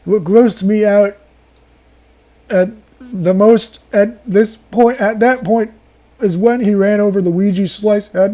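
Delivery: ordinary delivery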